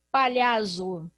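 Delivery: palhazo with a z sound, said slowly; wrong reading. Shown as a demonstration. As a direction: drag out palhazo with a buzzing z. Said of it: "vai ficar".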